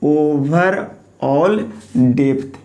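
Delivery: overall stretched out very slowly; teacher dictating a term word by word; slow dictation. Shown as a demonstration. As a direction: say overall slowly, then depth. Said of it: "क्या बोलते हैं?".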